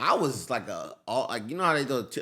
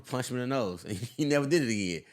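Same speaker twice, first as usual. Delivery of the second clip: ordinary delivery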